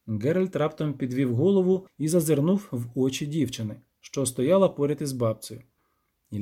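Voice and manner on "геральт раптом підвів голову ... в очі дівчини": ordinary delivery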